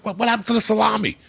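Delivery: high, fast, incredulous protest; retold outraged complaint, voice jumping up; indignant bafflement. Retelling his baffled protest at picnic.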